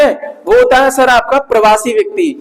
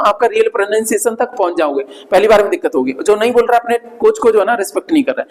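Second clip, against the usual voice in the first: normal talking voice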